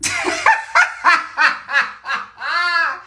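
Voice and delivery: high burst of real laughter; cracking up at a funny comment; big laugh. Real, not acted.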